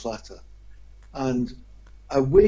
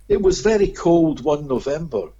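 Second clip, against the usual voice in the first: ordinary delivery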